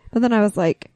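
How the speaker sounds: ordinary delivery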